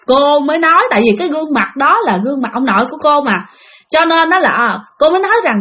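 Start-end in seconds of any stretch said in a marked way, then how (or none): none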